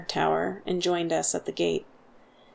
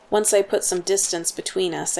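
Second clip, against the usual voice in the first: ordinary delivery, nothing marked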